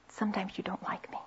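whispered, fast, barely voiced; hushed confession. Wife confessing to husband she feels unliked, whispered. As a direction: let the hurt slip out in a whisper.

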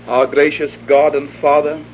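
formal solemn invocation; public prayer. Real, not acted. Preacher prays aloud over the congregation, solemn.

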